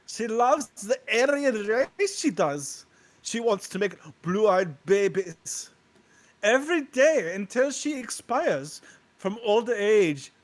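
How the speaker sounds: hammed German voice, dragged out; German accent. Mocking the actress's fans in a German accent. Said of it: "what'd you think of my German accent".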